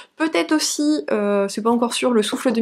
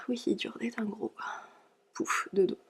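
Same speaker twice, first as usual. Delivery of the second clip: whispering; 12 dB quieter than usual. Real, not acted.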